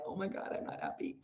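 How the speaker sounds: breathy whispered "I'm not happy"; deflated realisation, barely voiced; whispered letdown. Someone who got everything they wanted, whispering disappointment. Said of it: "then realize".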